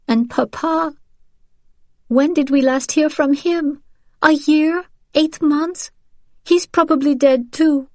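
a girl voiced high, pushing back through grief; distraught teenage daughter. Teenage daughter to mother, sure her father is dead.

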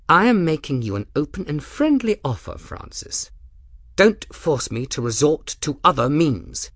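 a friendly offer with a knife behind it; Rook's voice. Acted.

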